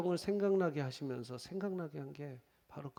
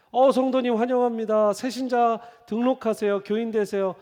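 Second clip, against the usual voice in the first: eager greeter; 6 semitones higher, 8 dB louder than usual. Retold or imitated. Greeter welcoming newcomer.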